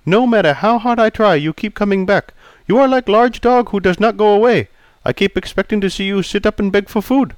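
put-on polite Chinese-accented barber greeting. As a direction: voice Pei the barber, courteous. Pei insults.

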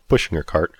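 ordinary delivery

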